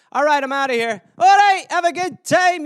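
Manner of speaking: high, loud Scottish send-off; Scottish guy. Acted. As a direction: call out a breezy goodbye as a Scot. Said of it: "the Scottish guy".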